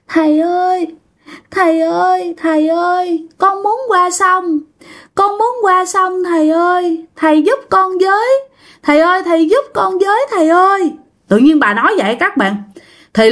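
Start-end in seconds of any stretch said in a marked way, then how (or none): 0.1-10.9 put-on much higher, pleading like a small child; little child's voice. Old woman possessed, begging the monk in a high childlike plea. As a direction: beg the monk as a frightened little kid. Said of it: "giọng của bà y như là một đứa con nít".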